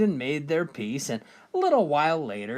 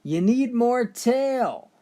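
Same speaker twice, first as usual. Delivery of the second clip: high, drawn-out mock yell; stretched, high-pitched call from a window; imitated calling out; 9 semitones higher, much slower than usual. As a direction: slowly holler it, as a wife calling to her husband. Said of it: "yells out to her husband".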